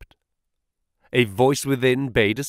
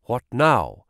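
plain reading voice

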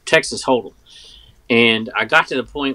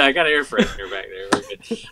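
normal talking voice

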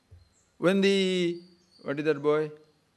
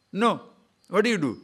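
normal talking voice

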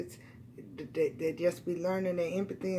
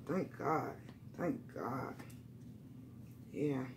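relieved murmur; 7 semitones lower, 14 dB quieter, slower than usual. Real, not acted.

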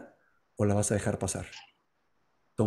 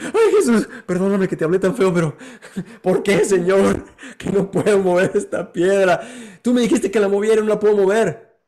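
pleading apology; 7 semitones higher, 6 dB louder than usual. Acted. Man pleads with Jesus.

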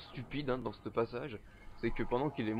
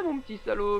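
startled; 9 semitones higher, 7 dB louder than usual. Real, not acted. Reacts to jump scare.

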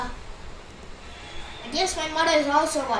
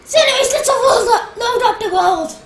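laughing; 8 semitones higher, 10 dB louder, faster than usual. Real, not acted. Excited start of a stream.

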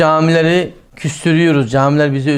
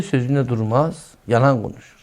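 ordinary delivery

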